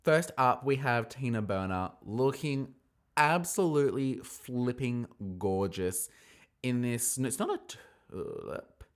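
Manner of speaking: ordinary delivery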